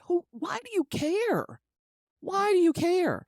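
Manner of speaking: high exasperated challenge; indignant high question. Acted. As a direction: demand it up high in disbelief.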